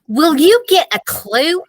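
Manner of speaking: high, loud scolding yell. Acted. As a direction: yell at him to get a clue. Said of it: "Megan yelled at me".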